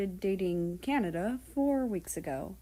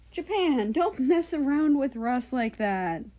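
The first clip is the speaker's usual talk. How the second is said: high, loud telling-off of a friend; loud scolding; 5 semitones higher, 6 dB louder than usual. Acted. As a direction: scold her sharply, voice up high. Japan scolds teasing friend.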